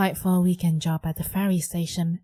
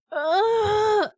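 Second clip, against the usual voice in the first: frustrated high groan; 14 semitones higher than usual. Acted. Teen's frustrated cry.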